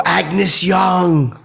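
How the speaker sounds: high, loud star-struck holler; excited shout. Retold or imitated. Fan calls the rock star a third time, excited shout. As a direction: holler it across the room.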